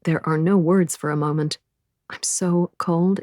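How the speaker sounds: usual speaking voice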